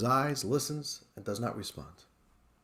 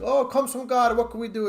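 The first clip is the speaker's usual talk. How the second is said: higher, louder mock piety; mocking pious shrug; 11 semitones higher, 7 dB louder than usual. Rebbe mocking the chassid's easy piety, loud mockery. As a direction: parody how easily he shrugs it off. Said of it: "You're willing to say".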